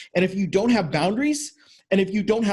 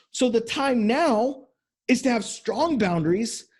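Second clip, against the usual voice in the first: usual speaking voice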